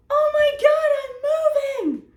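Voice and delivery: very high, loud, drawn-out squeal; stupid high schooler. Retold or imitated. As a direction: overreact like a silly panicking teenager. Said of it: "stupid high schooler reaction".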